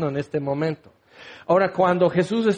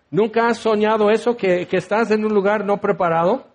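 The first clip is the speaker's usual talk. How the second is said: usual speaking voice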